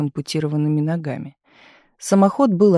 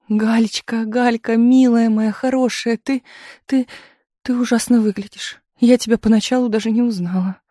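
tearful; 6 semitones higher than usual. A narrator's reading. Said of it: "плакала Таисия".

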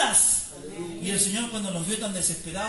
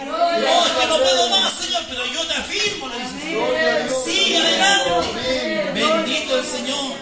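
preaching at full force; 5 semitones higher, 10 dB louder than usual. Acted. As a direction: preach it out to the congregation, almost singing. Preacher at climax of sermon.

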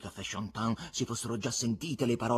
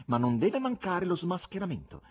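higher-pitched lordly insistence; patronizing; 8 semitones higher, 3 dB louder than usual. Acted. Patron demands the exposé from the buffoon, patronizing. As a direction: insist as if granting a favour. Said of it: "rispose con aria di protezione".

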